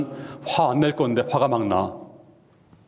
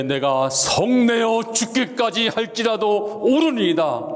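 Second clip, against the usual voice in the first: high, slow, stubborn retort; spiteful defiance; 9 semitones higher, slower than usual. Acted. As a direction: fling the words back out of spite. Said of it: "아주 오기로 말하는 겁니다". (오기 부리며 높고 느리게 대드는 말투)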